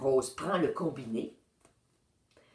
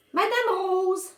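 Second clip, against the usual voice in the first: high, louder call across the yard; 5 dB louder than usual. A narrator's reading. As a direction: call her name from outside. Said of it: "appelle-t-il".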